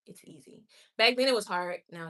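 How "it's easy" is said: whispered. Real, not acted. Telling viewers it's easy, whispered to herself. Correcting herself about watch hours.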